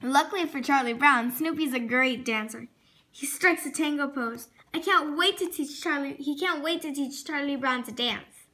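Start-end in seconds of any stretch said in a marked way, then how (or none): none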